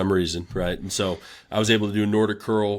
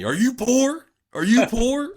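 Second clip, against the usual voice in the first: high, louder imitated scream of assistant coaches; mock screaming coaches; 4 dB louder, slower than usual. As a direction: yell it at the intern over and over. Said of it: "would just scream at me".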